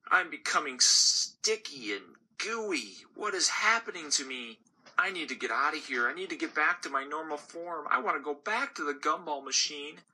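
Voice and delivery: a candy frantic to escape; panicking gumball. Acted.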